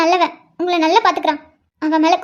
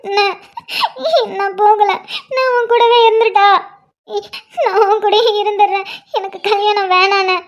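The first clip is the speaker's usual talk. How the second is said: sobbing plea to a brother, starting raised; tearful pleading. Acted. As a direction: cry and beg not to be sent away. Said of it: "இப்படிலாம் அழக்கூடாது". (அழுதுகொண்டே கெஞ்சும் குரல்)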